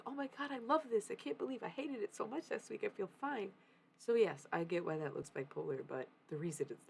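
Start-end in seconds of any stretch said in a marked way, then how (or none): 0.1-3.5 high-pitched, re-enacted delight; relieved gushing. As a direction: gush as if the misery never happened. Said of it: "goes back to being like".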